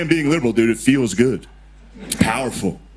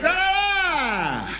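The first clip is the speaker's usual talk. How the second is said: sudden high yelp, much higher, snapping awake; mock Biden startle; 4 dB quieter than usual. Comic retelling Biden between teleprompters, high mock yelp. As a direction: jolt out with a shrill-free sudden "Ah!" like waking mid-speech. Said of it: "He'd be like".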